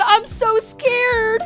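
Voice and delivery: friend's scared text read aloud in mock fright. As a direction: drag out the fake fear. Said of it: "Huxley said".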